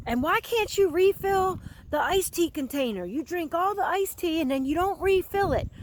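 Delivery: high, pushed-up ranting complaint; ranting roommate. Retold or imitated. Retelling a taped rant about a roommate, mock indignant. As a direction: mimic a fed-up guy nagging his roommate. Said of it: "going on a rant".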